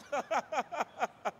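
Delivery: a bright, high burst of laughter. Real, not acted. Preacher laughing at his own story to the congregation.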